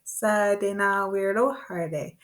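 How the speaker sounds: "Sa de na weirdo herde" slow and even; slow practice version. Shown as a demonstration. Teacher practising a long word with learners, slow measured chant. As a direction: hold a steady beat on "Sa de na weirdo herde". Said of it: "let's break it down a little bit".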